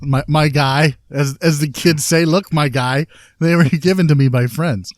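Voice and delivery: ordinary talk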